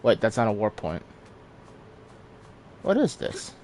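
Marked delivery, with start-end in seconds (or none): 2.8-3.5 high surprised question; suddenly puzzled, pitch jumping up. Real, not acted. Sudden find in game.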